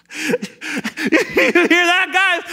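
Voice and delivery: rally the others to laugh; jeering soldier. Acted. Soldier calls to comrades.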